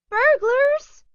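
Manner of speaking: put-on high, loud girlish cry; excited cry. A narrator's reading. Daughter rushing in with camera, high excited cry. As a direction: squeal it out, eager. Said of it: "cried Twinkle Little".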